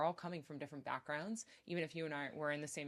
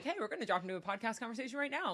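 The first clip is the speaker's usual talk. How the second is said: higher, louder, quick and bright; hammed-up eagerness springing a podcast; a touch of overexcited host; mock eager announcement; 7 semitones higher, 5 dB louder, faster than usual. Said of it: "it's like".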